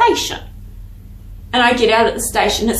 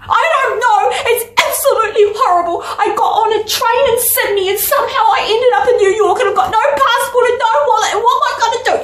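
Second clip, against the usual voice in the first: much higher, laughing, fast tumbling complaint; laughing distress; 11 semitones higher, faster than usual. Retold or imitated. Stranded dreamer telling her friend everything, high laughing panic. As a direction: pour out your predicament half-laughing.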